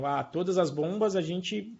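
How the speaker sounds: ordinary talk, nothing marked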